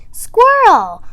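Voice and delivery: high, loud call. A narrator's reading. Frog calls to Squirrel up the tree, loud.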